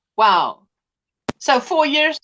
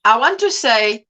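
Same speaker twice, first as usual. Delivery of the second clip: ordinary talk, nothing marked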